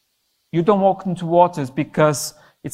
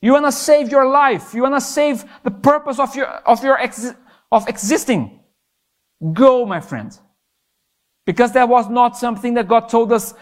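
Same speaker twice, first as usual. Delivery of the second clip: higher, louder, slowed sermon build; fervent preaching; 6 semitones higher, 4 dB louder than usual. Acted. Preacher urges the youth to stop doubting, fervent preaching. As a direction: drive it home to the congregation with rising fervor.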